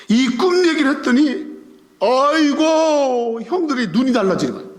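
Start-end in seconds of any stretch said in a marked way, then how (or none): 2.0-3.4 long drawn-out, high exclamation; hammed-up, wailing astonishment; mock dismay. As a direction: stretch out a theatrical "oh my". Acting the brothers' shocked reaction.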